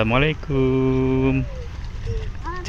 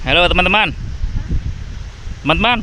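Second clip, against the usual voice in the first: calling out; 6 semitones higher, much faster than usual. Real, not acted. Greets children from afar.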